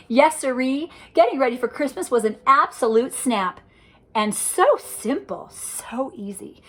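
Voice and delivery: ordinary delivery, nothing marked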